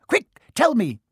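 high, loud urgent demand; impatient caretaker barking an order; Filch. A narrator's reading. Filch pressing Peeves for an answer, demanding. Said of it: "Filch was saying".

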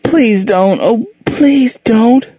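put-on little girl begging her stepfather to leave her alone; frightened begging. A narrator's reading. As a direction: beg him to stop, terrified. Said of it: "she begged".

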